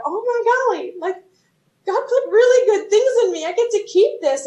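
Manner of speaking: light up at the discovery; amazed joy. Retold or imitated. Telling the congregation her delight at God's gifts, high and giddy. Retold joyful realization.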